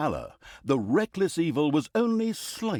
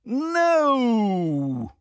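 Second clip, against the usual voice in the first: high, loud cry of protest; dramatic no; 9 semitones higher, 6 dB louder than usual. Acted. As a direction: shout it down in horror. Narrator cries out in protest.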